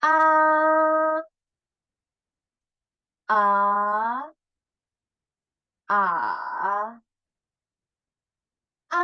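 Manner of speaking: A level, rising, dipping, falling; all four tones on A. Shown as a demonstration. Teacher repeating the four Mandarin tones on A. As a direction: run A through the four tones. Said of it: "kita ulang lagi ya".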